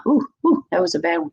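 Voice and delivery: ordinary talk, nothing marked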